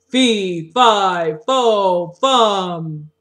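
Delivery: loud ogre chant, drawn-out words. Shown as a demonstration. Teacher showing children how to make the ogre's voice, loud ogre. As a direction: call it out like a big ogre. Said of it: "you make the voice of the ogre and you say".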